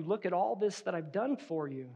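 soft, mildly uneasy pleading; a little nervous. Acted. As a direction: plead your case quietly, a bit unsure. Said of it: "a little nervous in your reply".

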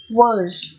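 "words" as weak "wəz", schwa, no R or D; weak form. Shown as a demonstration. Teacher rereading the three forms, light schwa in "words". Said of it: "Đọc nhẹ này".

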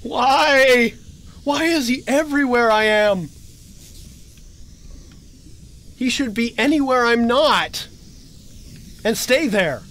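loud, high frustrated whine; exasperated complaint. Real, not acted. Complaining to the monster that keeps following, exasperated. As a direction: vent at the creature that won't leave.

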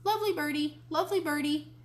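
high-pitched mynah echo; bird voice. A narrator's reading. Mynah bird repeating Grandma's praise, bird voice. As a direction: parrot it high and repetitive. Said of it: "Uncle Feather said".

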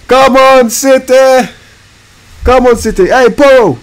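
high, loud "come on" chant; rally the team, way up high; cheering shout. Real, not acted. Gamer urging his City side on, high cheering shout.

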